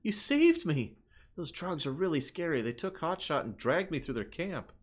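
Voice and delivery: put-on a frightened little kid thanking a rescuer; scared kid voice. Voicing a rescued NPC kid.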